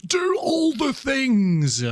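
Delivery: high slow cheer. Acted. Streamer egging chat on to spam emotes, high slow cheer. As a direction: stretch it out high like a cartoon cheerleader.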